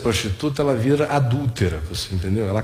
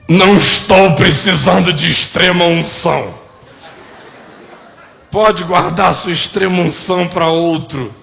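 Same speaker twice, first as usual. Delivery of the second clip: loud, higher-pitched mock rebuff; sarcastic refusal; 5 semitones higher, 7 dB louder than usual. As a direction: wave the visitor away loudly, with sarcasm. Acting the sarcastic sick man's refusal.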